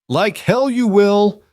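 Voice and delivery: a high, loud, slow burst of defiance; explosive outburst. A narrator's reading. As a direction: explode at him, refusing to give her up. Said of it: "The words exploded from Nora with such force".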